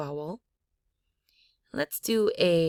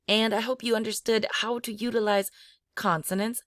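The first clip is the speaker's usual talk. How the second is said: normal talking voice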